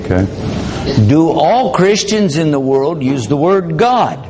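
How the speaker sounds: ordinary talk, nothing marked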